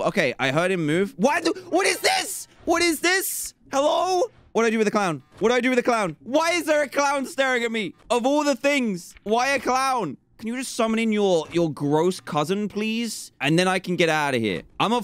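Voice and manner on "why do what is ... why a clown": higher, uneasy questions; nervous high-pitched disbelief; high nervous alarm. Real, not acted. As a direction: fret at the clown. Clown appears on camera.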